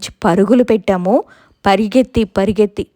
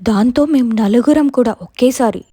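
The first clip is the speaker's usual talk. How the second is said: plain reading voice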